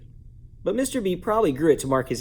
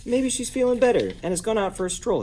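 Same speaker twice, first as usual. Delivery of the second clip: hopeful high thought; 11 semitones higher than usual. A narrator's reading. Mister Bundy's hopeful thought.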